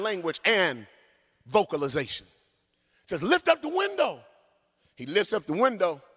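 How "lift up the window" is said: urgent call. Retold or imitated. Father shouting to trapped son.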